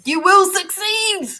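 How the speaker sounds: can't keep a straight face on the promise; laughing at herself. Real, not acted.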